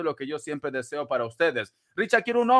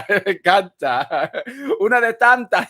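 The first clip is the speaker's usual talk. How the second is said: reading the comment through giggles; real laughter over the words; laughing through it; 6 dB louder than usual. Real, not acted. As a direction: laugh while you read it out. Reading viewer comment while laughing.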